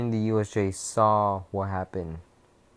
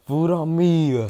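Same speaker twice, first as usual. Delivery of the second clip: loud, higher mock boast; cocky shout; 7 semitones higher, 7 dB louder than usual. Ghost Rider Deku boasting to the class, loud and higher. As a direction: shout it like treating everyone. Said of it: "Ghost Rider Deku saying".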